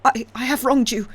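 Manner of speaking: high, loud, stammering shriek; shrieking confession. A narrator's reading. Father on his knees before the stranger, shrieking. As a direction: cry it out on your knees. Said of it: "shrieked the old man".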